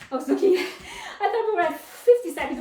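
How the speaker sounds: laughing at her own slip. Real, not acted. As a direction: giggle through the correction. Laughs at own counting mistake.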